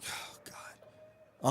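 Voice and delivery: breathy whispered "oh God"; a hushed, voiceless groan of disbelief. Retold or imitated. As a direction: mutter it under your breath in dismay. Groaning at the hundred-dollar charge.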